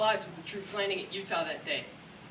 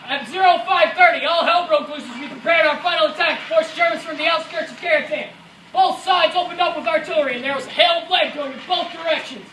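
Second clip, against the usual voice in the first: loud combat storytelling; raised-voice battle account; 5 semitones higher, 9 dB louder than usual. Acted. Soldier recalling all hell breaking loose, loudly. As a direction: project over the artillery.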